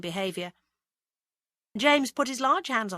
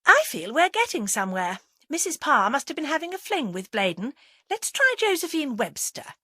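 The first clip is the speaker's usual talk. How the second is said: brisk cheer, louder and higher; cheerful; 8 semitones higher, 5 dB louder than usual. A narrator's reading. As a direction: press on keenly and cheerfully. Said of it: "said Agatha cheerfully".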